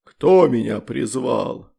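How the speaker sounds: very slow, booming monster voice; booming demon rumble. A narrator's reading. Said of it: "гулко пророкотал".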